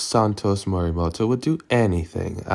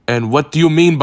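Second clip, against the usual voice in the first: startled interruption; 7 semitones higher, 7 dB louder, faster than usual. Acted. Interrupted, cut off mid-question.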